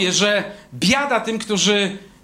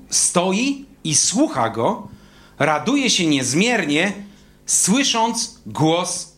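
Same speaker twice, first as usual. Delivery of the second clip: slow savoring; much slower than usual. Shown as a demonstration. Savoring the joy of listening.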